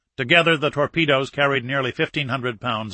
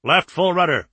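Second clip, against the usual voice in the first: higher, firm command; helm order; 5 semitones higher, 4 dB louder than usual. Acted. Captain to helm after launch, firm command. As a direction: call the turn out sharply.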